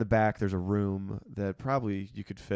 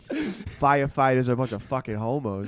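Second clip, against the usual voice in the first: put-on Boston accent; 3 semitones higher, 5 dB louder than usual. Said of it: "so that was from Departed".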